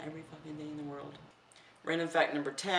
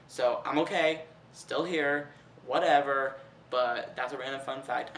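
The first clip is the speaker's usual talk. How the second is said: ordinary talk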